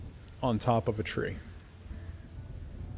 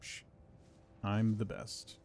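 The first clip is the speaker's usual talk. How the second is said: ordinary talk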